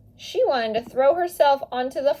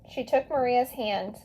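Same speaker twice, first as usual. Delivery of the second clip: ordinary delivery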